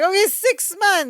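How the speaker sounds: high, squeezed-out mimicked concession; strained agreement. Larry gives in while getting bear hugged, strained high voice.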